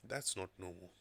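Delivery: ordinary delivery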